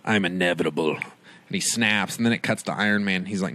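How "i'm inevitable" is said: much lower, menacing villain growl; low, cold Thanos line; Thanos menace. Retold or imitated. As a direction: deliver it like the unstoppable villain. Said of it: "the badass line of where Thanos".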